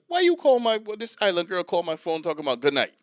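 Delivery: high, loud mock complaint; indignant co-worker. Co-worker complaining about island girl's call, high mock indignation.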